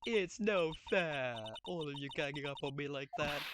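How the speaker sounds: high, slow wailing whine; fake crying. Cornered witness whining tearfully at the ganging-up court. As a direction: whimper like a sulky child. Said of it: "she's crying".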